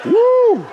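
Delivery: sudden high, loud "woo!"; whoop. Real, not acted. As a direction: let a joyful whoop fly out. Reacting to expensive honey prop.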